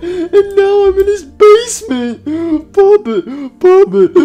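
very high, loud blubbering; crying. Acted. Freddy confesses to Puppet about stranger danger, crying. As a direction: bawl the confession between gasps. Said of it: "Stop crying".

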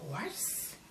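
soft whispered question. Acted. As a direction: whisper the word gently.

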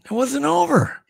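high, gleeful teasing reveal; relishing a story. Acted. Host hyping the clip continues.

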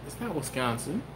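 quiet, unsure statement; doubtful guess. Acted. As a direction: say it softly, half to yourself. Debating a guess with himself.

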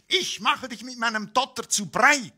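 high, crowing threat from a tiny egg. A narrator's reading. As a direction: crow it like a cocky little egg. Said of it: "krähte das Ei".

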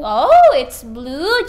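loud, slow, delighted oh; excited praise. Real, not acted. Praising child's answer.

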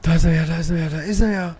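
loud alarmed shouting; startled cry at the monster; being chased. Real, not acted. Spots the monster. (erschrockenes lautes Rufen)

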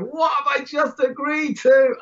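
a man who just took a job he can't do, aghast; panicked disbelief. Retold or imitated. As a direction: cry out in retold alarm at what you've signed up for. Walking out after agreeing.

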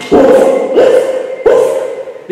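short breathy barks; dog woof. Shown as a demonstration. Teacher showing the dog's sound, separated woofs. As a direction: bark like a dog, one woof at a time. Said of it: "A dog makes sound".

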